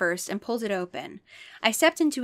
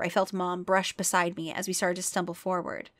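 ordinary delivery

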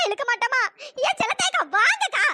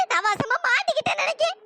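ordinary talk, nothing marked

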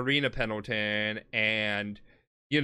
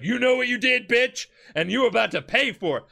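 angry threat; 8 semitones higher, 4 dB louder than usual. Retold or imitated. JoJo threatening Dio.

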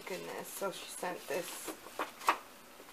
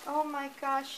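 ordinary delivery

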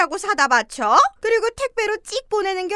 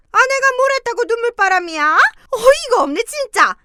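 1 irritated scolding; 6 semitones higher, 6 dB louder than usual. Acted. Mother-in-law scolds crying daughter-in-law.

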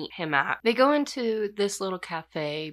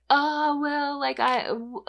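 playing dumb; 9 semitones higher, slower than usual. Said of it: "just playing dumb".

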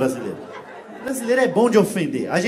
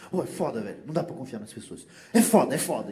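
lower, grumbling complaint; mock fed-up guy; 7 semitones lower, 3 dB quieter than usual. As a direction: mutter like a man fed up. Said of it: "deles tava muito puto". (cara irritado resmungando)

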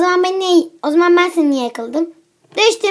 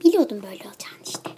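fast, lower whispered aside; whispered grumble; 5 semitones lower, 3 dB quieter, much faster than usual. Acted. Mother grumbling to herself that she knew it, fast whisper. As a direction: whisper it fast to yourself, annoyed.

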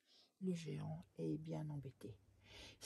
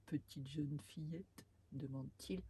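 plain reading voice

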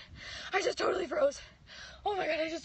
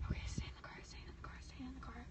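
whisper; 12 dB quieter, faster than usual. Real, not acted. Hiding, talking herself down.

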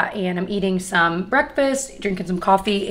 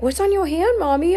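put-on toddler voice; 10 semitones higher, 3 dB louder than usual. Toddler daughter quoted.

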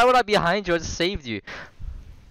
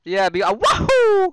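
high, loud, cartoonish wahoo cheer; Mario impression; 6 dB louder than usual. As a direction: shout it like Mario jumping. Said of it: "See, I'm Mario".

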